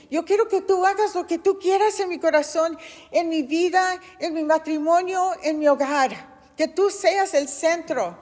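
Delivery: building to a loud, high plea; fervent surrender prayer. Retold or imitated. A woman surrendering completely to God, crying out loud and fervently. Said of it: "yo dije, "Señor, ya no aguanto esto".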